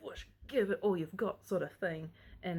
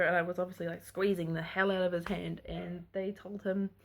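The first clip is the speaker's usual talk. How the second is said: ordinary delivery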